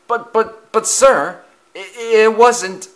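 high, loud stammered protest. A narrator's reading. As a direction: plead helplessly with the boss. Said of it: "the two engines protested".

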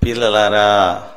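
low, slow fatherly call to disciples; Jesus. Retold or imitated. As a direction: draw it out, deep and tender. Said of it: "ఏమని పిలిచేవాడు?". (లోతుగా, నెమ్మదిగా పిలుపు)

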